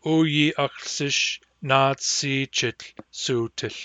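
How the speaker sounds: slow, careful teacher model; slow pronunciation demo; slow model phrase. Shown as a demonstration. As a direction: say it slowly for the class. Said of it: "break this one down".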